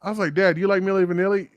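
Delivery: normal talking voice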